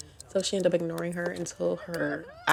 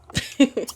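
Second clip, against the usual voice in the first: loud high laugh; 7 semitones higher, 7 dB louder than usual. Real, not acted. Laughs at three dancing guests.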